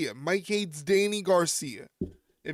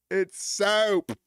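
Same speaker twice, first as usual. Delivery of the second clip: mock Southern accent; 3 semitones higher, 4 dB louder than usual. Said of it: "that's how people down south in Tennessee talk".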